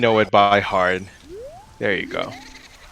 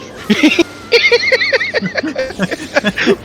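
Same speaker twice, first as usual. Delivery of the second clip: high laugh; 4 dB louder than usual. Real, not acted. Laughing before begging Mel.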